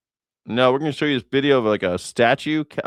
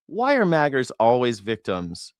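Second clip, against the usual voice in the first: ordinary delivery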